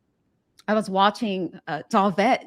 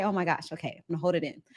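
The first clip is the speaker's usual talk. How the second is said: softer, choked-up, fighting tears; holding back tears; 2 semitones lower, 7 dB quieter, faster than usual. Real, not acted. Host tells the singer what her song meant, real choked softness. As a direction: keep it in as the emotion rises. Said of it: "try my best not to cry".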